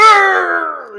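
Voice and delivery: high, loud "arr"; loud mock growl. Mimicking a crowd eating it up, loud mock roar. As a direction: let out a rowdy roar.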